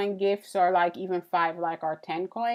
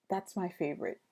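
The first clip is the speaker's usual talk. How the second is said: hushed, much softer confession; near-whisper; 11 dB quieter, faster than usual. Real, not acted. Streamer confiding a favorite to Grace, hushed for dramatic effect. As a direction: drop to a secretive undertone for effect. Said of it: "I don't know why I'm whispering".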